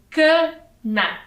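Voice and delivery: "cana" slowly split, ca... na; syllable by syllable. Shown as a demonstration. Teacher repeating the word for children, syllable by syllable. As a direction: pause between the two halves of "cana". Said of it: "e duas sílabas".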